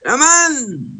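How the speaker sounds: high, drawn-out call; demonstrated clamour; crying out. Shown as a demonstration. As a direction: stretch CLAMANDO out high like a cry. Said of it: "clamar quiere decir gritar".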